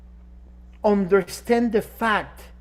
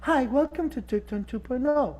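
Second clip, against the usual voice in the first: quiet, higher, gentle greeting; soft sweet put-on voice; 3 semitones higher, 5 dB quieter than usual. Shown as a demonstration. Host mocks a gentle public persona, softly cooing. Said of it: "Imagine if I talk like this".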